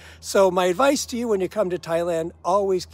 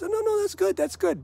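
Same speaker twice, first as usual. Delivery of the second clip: much higher, sweet reassuring teacher; 14 semitones higher than usual. Retold or imitated. Teacher praising the student's Thai, high and sweet. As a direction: gush praise like a kind tutor. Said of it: "My teacher says".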